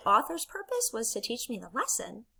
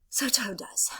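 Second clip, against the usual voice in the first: ordinary talk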